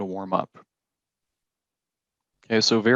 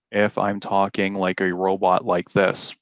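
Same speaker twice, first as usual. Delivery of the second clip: completely flat robotic delivery; robot monotone; 3 semitones lower than usual. Shown as a demonstration. Teacher showing students a flat delivery, robotic monotone. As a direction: talk like a robot, every word on one note. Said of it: "we call that monotone".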